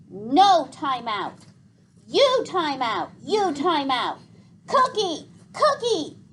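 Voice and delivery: put-on high, loud, slow toddler tantrum; sputtering toddler. A narrator's reading. Boots refuses a timeout from Gregor, sputtering toddler tantrum. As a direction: spit out the words like a furious two-year-old. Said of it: "sputtered Boots".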